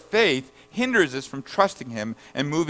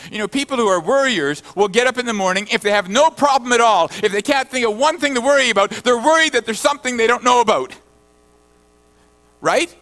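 higher, louder, faster preacher's run; impassioned preaching; 8 semitones higher, 6 dB louder, faster than usual. Acted. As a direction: push the point up to the rafters. Preacher builds on worriers.

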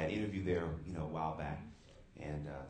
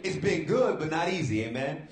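preaching loud; 8 semitones higher, 8 dB louder than usual. Real, not acted. Preacher rising to a call.

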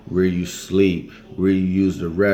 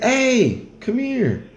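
friendly local calling out; slower than usual. Retold or imitated. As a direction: wave a stranger over in a squeaky voice. Locals calling him over.

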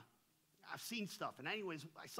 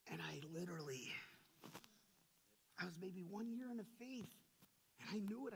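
usual speaking voice

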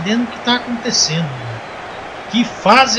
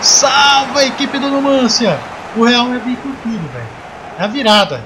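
play-by-play commentary; 3 semitones higher, slower than usual. Acted. Live football play-by-play.

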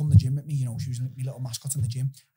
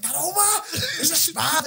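high, laughing panic; panicked high call; 13 semitones higher, 4 dB louder than usual. Retold or imitated. Waking Omar about the spider, high panicky call. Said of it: "I was like".

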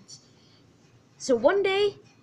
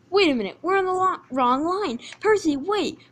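higher, urgent call to halt; alarmed driver; 6 semitones higher, 5 dB louder than usual. Acted. Driver warns Percy of the wrong line, alarmed. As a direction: call out a hurried warning.

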